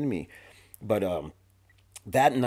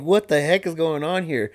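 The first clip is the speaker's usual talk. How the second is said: retold shock; 7 semitones higher, 6 dB louder than usual. Man swings a knife at him.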